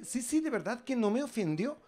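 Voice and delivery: a hint of a meek polite lad. Young man voiced politely. (el chico ecuatoriano, educado y agudo)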